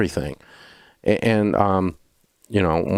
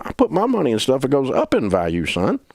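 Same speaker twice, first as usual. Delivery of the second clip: raised-pitch, emphatic mock scolding; heated retold lecture; lecturing grandpa; 5 semitones higher than usual. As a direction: imitate grandpa setting his grandson straight. Grandpa lectures grandson in acted quarrel.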